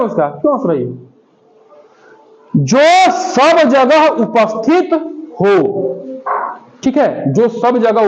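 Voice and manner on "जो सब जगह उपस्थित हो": word by word, with gaps, very slow; drawn-out reading for note-takers; slow dictation. Shown as a demonstration. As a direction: dictate it slowly, pausing before the last word. Teacher dictates the phrase to define.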